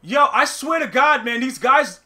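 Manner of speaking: venting after the drop; loud frustration. Real, not acted. Streamer venting about his opponent, loud frustrated rant.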